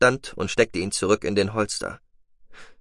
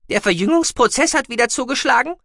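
very high, loud piping question; questioning mousebeaver; 5 dB louder than usual. A narrator's reading. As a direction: ask high and urgently. Guki asks about her nausea. (hohe, laute Mausbiberstimme, fragend)

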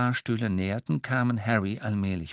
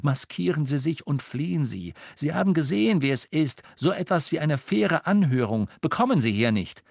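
higher-pitched, insistent urging; urgent warning; 6 semitones higher, 3 dB louder than usual. Acted. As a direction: urge them to flee at once. Harry urges prisoners to flee.

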